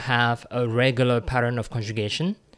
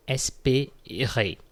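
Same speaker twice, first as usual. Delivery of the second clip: "Espérer" spoken very slowly, each syllable clear; slow, careful espérer; much slower than usual. Shown as a demonstration. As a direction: model "Espérer" slowly for the class. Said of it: "comes from espérer".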